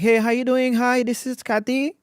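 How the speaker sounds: high, drawn-out receptionist hello; sing-song high phone greeting; assistant's phone greeting. Retold or imitated. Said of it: "She would be like".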